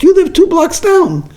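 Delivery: high, emphatic disbelief; incredulous daughter. Acted. Daughter telling the stranger she lives nearby, high and incredulous. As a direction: insist on the obvious, amazed. Daughter correcting her mother.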